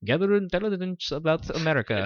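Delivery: a lightly put-on, admittedly bad Russian accent; a hammy Russian spy voice, half-joking. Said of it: "terrible accent".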